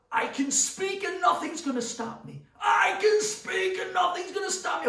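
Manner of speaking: high, loud scream. Retold or imitated. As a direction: scream it with all your strength. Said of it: "scream on the top of your lungs".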